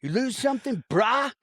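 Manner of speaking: high-pitched, hammed-up surfer bro; try-hard surfer. Podcaster mocking a film villain's line to the co-host, try-hard surfer. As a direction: put on an over-eager surfer voice. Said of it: "that bra line also sends me".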